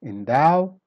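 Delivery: "Endow" rising to a loud "dow"; weak then strong. Shown as a demonstration. Teacher modelling the word, "Endow" stressed on the end. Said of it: "then become strong".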